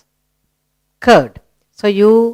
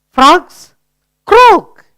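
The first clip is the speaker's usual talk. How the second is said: lively croak; 9 semitones higher, 6 dB louder than usual. Shown as a demonstration. Teacher models frog sound.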